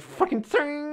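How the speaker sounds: high sharp ting sound imitating snapping wire; imitated ting. Telling mates how the wires snapped, high ting. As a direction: squeak out the snap of the wire.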